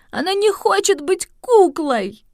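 put-on high, whiny child's wail; whining. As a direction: whimper it like a little girl. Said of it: "захныкала бедная Энрика".

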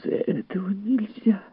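high, halting lady's protest; mild high-voiced lament; plaintive wail. A narrator's reading. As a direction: object pleadingly, pitched well up. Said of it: "жалобно возопила миссис Бауэллс".

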